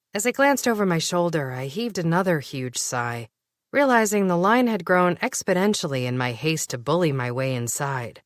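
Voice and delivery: ordinary delivery, nothing marked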